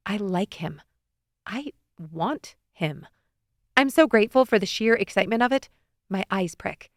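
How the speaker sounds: usual speaking voice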